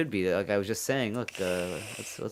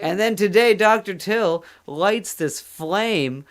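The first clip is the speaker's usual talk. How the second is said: higher, louder, drawn-out mock indignation; mock outrage; 7 semitones higher, 6 dB louder, slower than usual. Host hamming up outrage at Till's Thanksgiving war. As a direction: build it up like an epic grievance.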